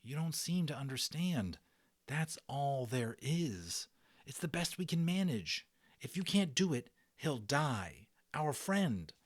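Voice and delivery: put-on earnest pleading boy. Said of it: "Omri said earnestly".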